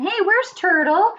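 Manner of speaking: high monkey voice calling out; questioning storybook monkey call; searching monkey. Acted. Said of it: "The monkey's looking for his friend".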